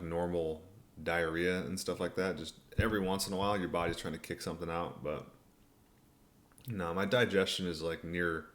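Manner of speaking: ordinary delivery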